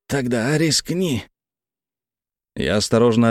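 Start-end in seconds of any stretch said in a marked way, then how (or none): 0.1-1.2 slow, higher-pitched challenge; stranger's voice. A narrator's reading. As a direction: dare them slowly in the accented stranger's voice. Stranger answers the gun threat.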